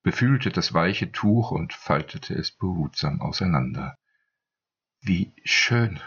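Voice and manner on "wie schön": lightly voiced stammer, a bit higher; a mild catch in the voice. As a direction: falter on the words, overwhelmed. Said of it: "stammelte sie es".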